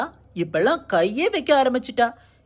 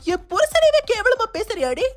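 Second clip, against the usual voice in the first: outraged scolding; 8 semitones higher than usual. Acted. Outraged quarrel with sister-in-law.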